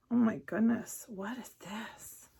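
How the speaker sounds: awed whisper. Real, not acted. Marveling at pillowcase in awe.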